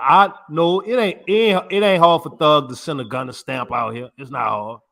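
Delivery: ordinary talk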